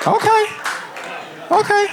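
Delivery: high-pitched little okay; put-out okay. Acted. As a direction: say it way up high, acting rejected. Rejected partner reacts in bit.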